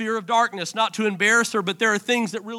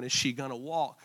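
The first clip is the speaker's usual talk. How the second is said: retold tearful worry; 8 semitones lower, 11 dB quieter than usual. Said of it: "I was crying, going".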